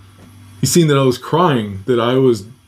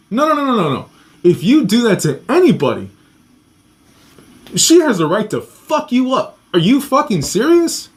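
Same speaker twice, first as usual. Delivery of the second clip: high-pitched, retold telling-off; indignant rebuke; 8 semitones higher than usual. Guard warning the strangler about the mother, indignant rebuke. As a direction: imitate an appalled guard laying down the law.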